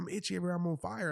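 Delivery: high imitated complaint; retold freak-out. Wife scratching ant bites in the car, imitated panic. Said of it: "she's still freak".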